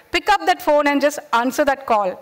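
ordinary talk

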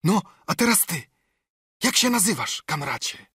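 a touch of eager hermit pleading for cheese; Ben Gunn voice. A narrator's reading. Ben Gunn asks the name. (lekko zagrany zdziczały rozbitek, wyżej)

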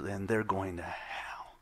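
whispered, slow and hushed; the preacher warning his church, hushed whisper; grave whisper. Real, not acted. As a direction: drop to a solemn whisper. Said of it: "grave whisper".

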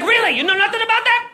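high-pitched incredulous needling; mock grilling. Cop teasing a panicking driver, high-pitched incredulity. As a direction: press with exaggerated high disbelief.

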